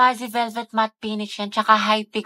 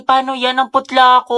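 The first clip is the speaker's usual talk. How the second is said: louder, whining mock panic; 2 semitones higher, 8 dB louder than usual. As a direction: wail about looking pale, hammed-up. Panics over pale lips. (OA na pagkataranta)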